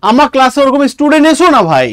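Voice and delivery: normal talking voice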